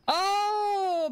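sharp high gasp of real surprise, then a high oh; startled gasp into a high oh; shocked gasp. Real, not acted. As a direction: caught off guard, gasp and blurt it out. Realises chat is emote only.

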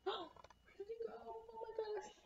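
high, soft, anxious aside; a soft high worried whisper to the kids; hushed worry. Acted. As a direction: gasp softly as if the frog is lost.

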